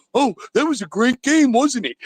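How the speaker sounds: high, loud gloating mock voice; mocking Steeler fan. Caller mocking his Steeler friends, high smug voice. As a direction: sneer it like a smug rival fan. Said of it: "I gotta hear my Pittsburgh fans".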